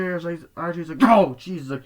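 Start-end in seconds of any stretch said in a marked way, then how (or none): 1.0-1.7 high, loud jolt of surprise; acted-out fright; startled yelp. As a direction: blurt it as if startled. Acting out startled RJ in film.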